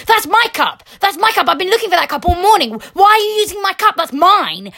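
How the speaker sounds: mock the childish coworker claiming his cup; possessive tantrum. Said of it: "and he was like".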